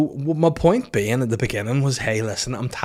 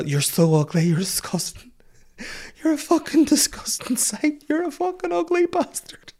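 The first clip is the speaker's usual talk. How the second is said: very high, squeaky imitation of a wailing mum; mock hysterical crying. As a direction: wail the insults in a hammed-up high voice. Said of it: "like, hysteric crying".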